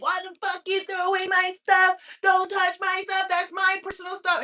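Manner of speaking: high, put-on whiny outrage; mock angry husband. Imitating husband upset over thrown-away stuff, mock angry. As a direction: imitate him throwing a high-pitched fit. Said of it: "he would have been so mad".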